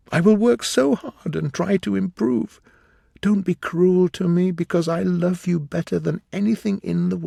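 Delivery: put-on high, imploring young woman's plea; Sybil's tearful pleading. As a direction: beg him not to go. Sybil begging Dorian.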